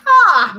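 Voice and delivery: loud, high cry of pain; pained yell. A narrator's reading. Monster yelling as the branch hits her. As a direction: cry out as struck. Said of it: "Medusa yelled".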